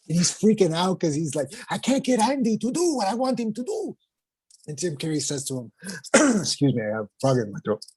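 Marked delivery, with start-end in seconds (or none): 1.7-4.0 voicing the director. Director freaking out, quoted.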